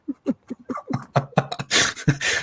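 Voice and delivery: soft chuckling laughter; real, mostly breathy chuckle; amused laugh. Real, not acted. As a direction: laugh quietly at your own dark joke. Laughs after own sarcastic suggestion.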